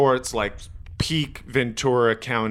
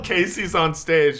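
ordinary talk, nothing marked

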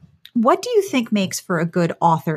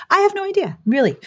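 mock clueless; 12 semitones higher, faster than usual. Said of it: "And it's like".

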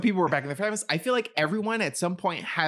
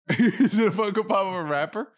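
asked again through a laugh, a bit louder; 5 semitones higher, 4 dB louder than usual. Real, not acted. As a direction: laugh while pushing the guess. Repeats the guess, laughing.

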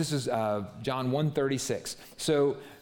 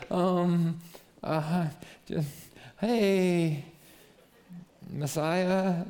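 hesitant, drawn-out mock stammer, pitched up; nervous choking; 5 semitones higher, much slower than usual. Andrew choking in front of the Messiah, awkward hesitation. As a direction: grope awkwardly for words, starstruck. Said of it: "like, chokes".

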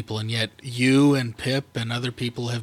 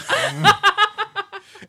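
laughing; 4 dB louder than usual. Real, not acted.

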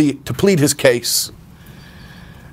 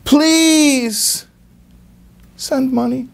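put-on high, slow pleading kid's voice; whiny son; 13 semitones higher, slower than usual. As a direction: beg like a homesick boy asking for cash. Son's letter home begging money.

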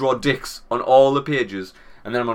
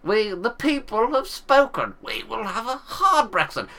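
much higher, slow, plummy mock politician; pompous MP mockery; 8 semitones higher, slower than usual. Mocking an MP demanding hard Brexit, slow pompous voice. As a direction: ham up a self-important MP addressing the Speaker.

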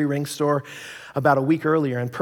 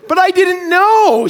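much higher, louder, slow falsetto; tearful wife; 10 dB louder, slower than usual. Retold or imitated. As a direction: plead it like the wife. Wife explaining through tears.

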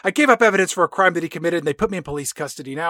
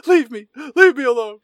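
higher, louder dramatic brush-off; mock angry dismissal; 7 semitones higher, 5 dB louder than usual. Kat sending her dad away, in a melodramatic snap. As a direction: push him away like a soap star.

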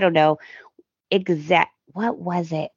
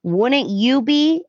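pointed question; 5 semitones higher, slower than usual. Retold or imitated. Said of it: "she looks at Ridge and she goes".

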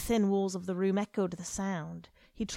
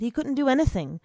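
frustrated outburst; 6 semitones higher, 8 dB louder, faster than usual. A narrator's reading. Frustration rising.

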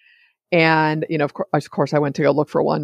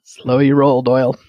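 self-scolding; 3 semitones lower, 5 dB louder than usual. Real, not acted. Scolding herself mid-hiccup.

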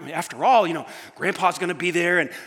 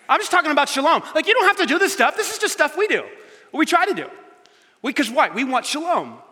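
emphatic; 8 semitones higher, 4 dB louder than usual. Real, not acted. Emphatic raised pitch.

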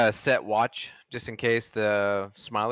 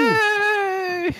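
excited cheer; 3 dB louder than usual. Real, not acted. Cheer as morning arrives.

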